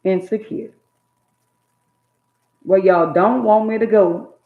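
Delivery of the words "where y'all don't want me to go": loud preached declaration; rising preacher's call; preaching shout. Real, not acted. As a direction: lift it to the congregation. Preaching climax to audience.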